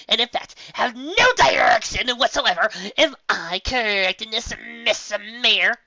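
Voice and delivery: a showman grilling the mayor loudly; Trixie lecturing. Acted.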